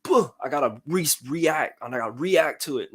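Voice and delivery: mock hurried overreaction; knee-jerk reacting. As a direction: blurt it out on pure reflex. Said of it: "I'm not".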